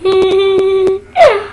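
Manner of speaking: high giggling laugh; giggle. Real, not acted. After calling herself funny, a child giggles. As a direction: laugh at your own joke.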